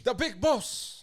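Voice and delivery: hype announcer. Acted. Hyping Daddy Yankee.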